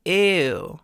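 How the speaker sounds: loud, thick, disgusted "ew"; heavy vocal weight, disgusted; emphatic ew. Shown as a demonstration. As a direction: push it out heavy, almost frying. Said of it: "emphatic ew sound".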